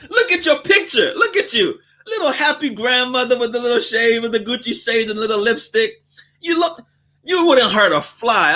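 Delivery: high, gushing sweet talk to the grandma; butter her up shamelessly; flattering customer. Acted.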